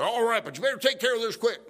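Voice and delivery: high, loud, hurried mock order; hothead judge. Judge ordering the sheriff to fix a problem, angry. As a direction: imitate an irate judge demanding action. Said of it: "he was a hothead".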